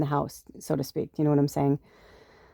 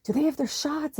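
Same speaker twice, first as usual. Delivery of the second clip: high, softer anxious question; upset, worried; 10 semitones higher, 4 dB quieter than usual. Retold or imitated. Landlord after the dog bite, high, worried question. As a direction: ask in a worried rush. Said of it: "he was really upset".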